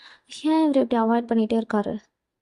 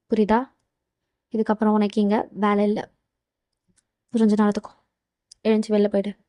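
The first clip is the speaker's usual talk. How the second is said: stern boss; 4 semitones lower, 4 dB quieter than usual. Acted. JK firing Leah, low stern voice.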